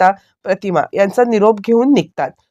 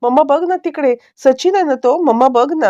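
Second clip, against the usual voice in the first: mock shouting; 7 semitones higher than usual. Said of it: "ओरडायला लागली आहे".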